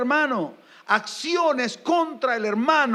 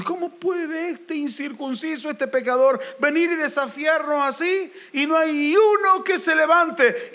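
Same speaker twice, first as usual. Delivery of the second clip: high, loud indignant outcry; David's angry challenge; 8 semitones higher, 3 dB louder than usual. Retold or imitated. Preacher voicing David outraged at Goliath's insults. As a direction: rail at the cowering army. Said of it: "David se enoja. Se enoja. Dice".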